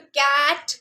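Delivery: कैट loud and stretched, "kaaat" as one blend; joined-up word. Shown as a demonstration. Teacher joining the three sounds, loud blended कैट. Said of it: "फिर उसके बाद join करके".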